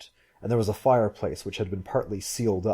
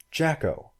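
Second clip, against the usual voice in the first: higher, savoring a new name; tasting the word; 7 semitones higher than usual. A narrator's reading. Woman repeats the stranger's name, tasting it. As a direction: try the name out, pitched up. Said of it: "She tasted the sound".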